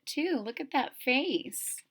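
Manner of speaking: ordinary delivery, nothing marked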